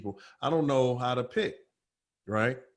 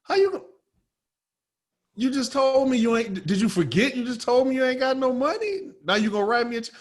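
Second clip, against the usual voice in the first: much higher, louder exasperated rant; incredulous rant; 10 semitones higher, 4 dB louder than usual. Retold or imitated. To the buyer who has no cash, incredulous rant. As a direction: call out the nonsense. Ranting at buyer about check.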